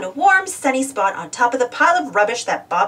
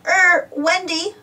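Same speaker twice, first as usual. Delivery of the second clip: slow, higher hesitant start; dreading telling her; nervous gulp; 7 semitones higher, slower than usual. A narrator's reading. Scoop nervously pointing out Wendy's wiggly line. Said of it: "gulped Scoop".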